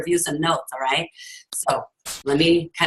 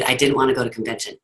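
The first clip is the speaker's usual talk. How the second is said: normal talking voice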